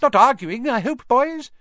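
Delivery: very high squeaky little professor. Acted. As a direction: squeak it out brightly. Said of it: "he squeaked".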